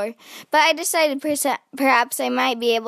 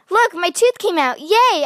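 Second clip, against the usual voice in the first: put-on high, loud delighted kid voice; bursting with joy at a lost tooth; excited child; 6 semitones higher, 5 dB louder than usual. A narrator's reading. Girl shows off her lost tooth, excited and loud. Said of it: "I said".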